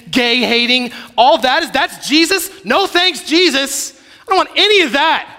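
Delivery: high, loud, fervent sermon peak; preaching at full force. Acted.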